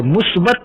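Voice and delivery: मुस्बत with u, slight rise; correct pronunciation. Shown as a demonstration. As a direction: say मुस्बत with mu and a lift. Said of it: "ये सही लफ्ज़ है". (सही उच्चारण मुस्बत)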